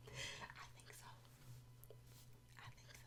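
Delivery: very soft, whispered verdict; conspiratorial whisper. Acted. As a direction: whisper the answer like a secret. Hushed answer to own question.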